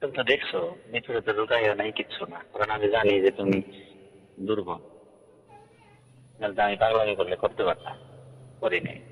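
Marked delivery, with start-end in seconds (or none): none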